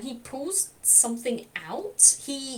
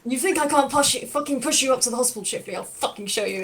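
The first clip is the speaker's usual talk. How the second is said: put-on louder, heated old man's threat; angry threat; 5 dB louder, faster than usual. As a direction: threaten him, fuming. Lau threatens to push Chifley.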